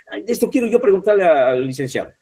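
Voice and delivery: quick, high-pitched eager pupil; eager student. Acted. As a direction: blurt it out like a student with a question. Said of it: "hay algunos que tienen memoria a corto plazo y dicen".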